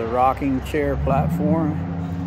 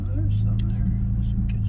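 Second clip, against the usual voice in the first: whisper; 12 semitones higher than usual. Real, not acted. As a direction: whisper it to yourself. Quiet aside.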